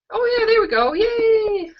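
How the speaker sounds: high, happy little yay; delighted cheer. Real, not acted. Host spots viewers arriving in the live chat, delighted cheer.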